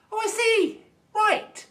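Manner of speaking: high sarcastic "oh I see". Real, not acted. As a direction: fake realisation, high and loud. Sarcastic reaction to hypocrisy.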